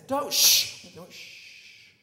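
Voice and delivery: acted-out panicky shushing; frantic shushing. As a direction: urgently stop someone making noise. Boys frantically hush him.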